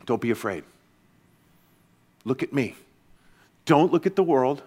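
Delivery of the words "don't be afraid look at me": much softer, lower, tender reassurance; gentle voice. Acted. Jesus looking Jairus in the eye, soft and tender. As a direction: hold his gaze and calm him softly. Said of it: "in a gentle voice".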